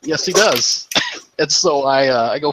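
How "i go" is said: ordinary delivery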